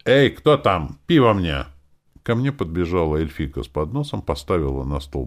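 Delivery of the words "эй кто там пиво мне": loud, raised call for service; brisk shout for a waiter; shouted summons. A narrator's reading. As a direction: call out across the tavern. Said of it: "поднял руку и крикнул".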